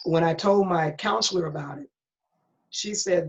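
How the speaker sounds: ordinary talk, nothing marked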